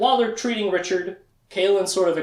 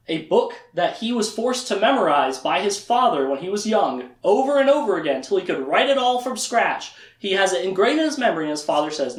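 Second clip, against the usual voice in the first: normal talking voice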